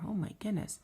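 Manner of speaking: ordinary delivery, nothing marked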